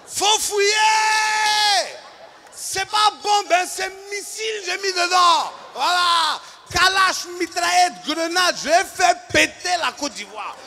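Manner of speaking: high, loud sarcastic tirade; mock-furious shouting rant. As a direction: unload all the anger on the cop. Venting anger at Abidjan policeman.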